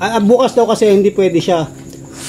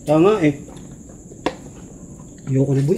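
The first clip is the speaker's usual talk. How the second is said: ordinary talk, nothing marked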